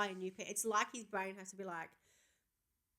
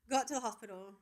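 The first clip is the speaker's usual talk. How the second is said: ordinary delivery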